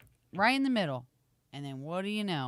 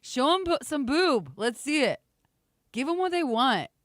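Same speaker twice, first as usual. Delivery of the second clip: loud teasing; 7 semitones higher, 6 dB louder than usual. Real, not acted. Teasing Gary loudly.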